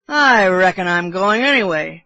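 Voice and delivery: loud, lightly voiced drunk sailor; drunken bosun. A narrator's reading. Sailor dismissing Kiawe's warning of hell, loud mild bravado. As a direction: brush the warning off with tipsy bravado. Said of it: "how drunken it appeared".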